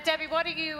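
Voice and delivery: ordinary talk, nothing marked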